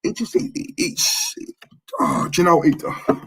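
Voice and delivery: stammering, breath catching, words failing; can't get it out, voice giving way; real upset, trailing into breath; choked up. Real, not acted. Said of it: "'cause it bloody hurts".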